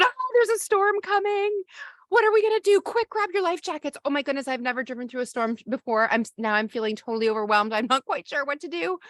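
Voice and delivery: hammed-up skipper losing it in the storm; panicked captain. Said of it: "if we get panicked and overwhelmed".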